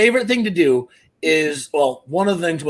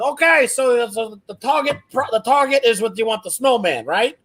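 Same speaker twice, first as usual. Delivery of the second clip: high, eager clueless caller act; dad baiting scammers; 7 semitones higher than usual. Retold or imitated. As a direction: play the muddled gift-card shopper, pitched up. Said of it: "I hear him goes".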